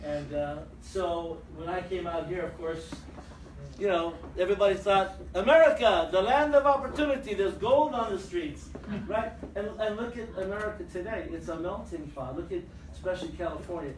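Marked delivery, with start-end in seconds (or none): 5.3-8.7 loud, high mock wonder; hammed-up awe, easing toward the end; starry-eyed proclamation. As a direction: proclaim it like a dazzled newcomer. Voicing immigrants' starry-eyed belief.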